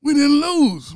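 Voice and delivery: loud high mock denial; mock insistent shout. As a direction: protest it to the commissioner. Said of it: "he says".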